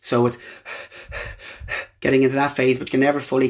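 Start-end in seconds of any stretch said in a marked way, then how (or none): 1.1-1.9 repeated voiceless gasps for air; short, soft, empty breaths, demonstrating an asthma attack; asthmatic gasping. Shown as a demonstration. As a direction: imitate struggling to catch a breath. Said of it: "trying to catch your breath".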